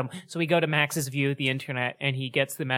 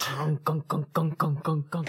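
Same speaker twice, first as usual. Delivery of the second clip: train clunking imitation; 2 semitones higher, slower than usual.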